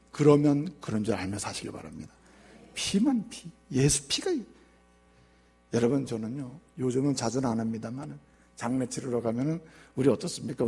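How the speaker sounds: ordinary talk, nothing marked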